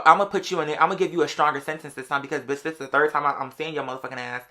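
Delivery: normal talking voice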